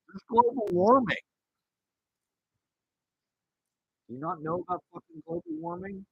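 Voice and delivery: whiny high comeback about global warming; mock nerd retort. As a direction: play the offended know-it-all. Mock nerd answering the bully.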